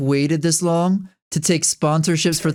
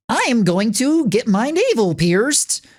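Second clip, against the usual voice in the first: put-on Jersey girl TTS voice; 6 semitones higher than usual. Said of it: "that goofy ass Jersey girl voice".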